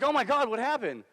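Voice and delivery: high, loud alarmed question; shocked exclamation. Retold or imitated. Squad to returning driver after the hit, shocked exclamation. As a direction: burst out in shock.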